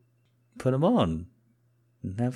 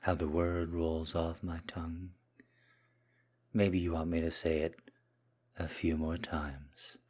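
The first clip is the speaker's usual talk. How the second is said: soft, hushed, lingering murmur; submissive seduction; 6 dB quieter than usual. Acted. Kneeling man serving his Mistress, soft seductive submission.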